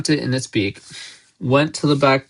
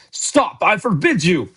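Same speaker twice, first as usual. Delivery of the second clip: panicked, high-pitched yell; Uncle Vernon; 7 semitones higher than usual. A narrator's reading. Uncle stopping Hagrid's revelation, panicked yell. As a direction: forbid it frantically, voice shooting up. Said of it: "yelled Uncle Vernon in panic".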